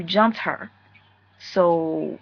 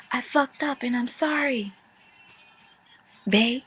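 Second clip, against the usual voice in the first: higher-pitched imitation, barely any louder; mock half-hearted louder apology; 4 semitones higher than usual. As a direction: pretend to raise it while still holding back. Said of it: "Say it a little louder".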